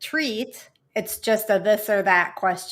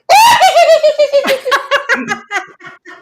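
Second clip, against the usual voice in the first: high, loud cackling witch laugh; witch cackle; 13 dB louder than usual. Pretend. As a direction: let rip a creepy witch cackle. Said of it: "Make your creepiest witch cackle".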